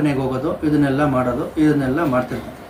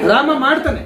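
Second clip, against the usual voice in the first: much higher, laughing exclamation; amazed emphasis; 7 semitones higher than usual. Real, not acted. Speaker marvels that Rama himself obeys, high laughing delight.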